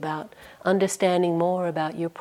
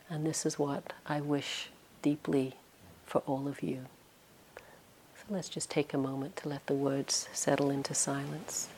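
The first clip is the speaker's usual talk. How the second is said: much softer, settling toward silence; soft closing blessing; 2 semitones lower, 9 dB quieter than usual. Real, not acted. Teacher wishing students well, softly closing the talk. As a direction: let the words fall gently into quiet.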